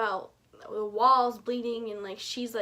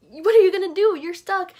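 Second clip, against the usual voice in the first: much louder and higher, panicked; frantic outburst; 7 semitones higher, 13 dB louder than usual. Retold or imitated. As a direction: shout the question at the book in distress. Frantic at Jason's dilemma.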